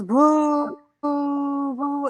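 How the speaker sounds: imitating a booing crowd. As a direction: echo the crowd jeering her name. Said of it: "the whole football team booing".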